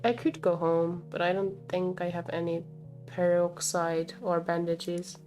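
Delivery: ordinary delivery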